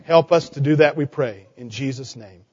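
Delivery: low, hushed prayer voice fading at the end; closing prayer. Acted. Pastor prays with the kids, low and hushed. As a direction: pray gently over the children.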